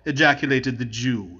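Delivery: usual speaking voice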